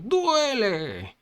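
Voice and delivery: high, pained yell; high drawn-out wail of pain; cry of pain. A narrator's reading. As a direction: shriek out the hurt as the victim. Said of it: "lo único que pudo es gritar".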